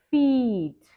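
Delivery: quick, clipped; fast. Shown as a demonstration. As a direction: say Feet briskly in one go. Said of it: "say some words slow and then fast".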